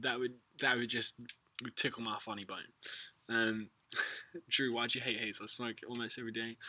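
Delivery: ordinary talk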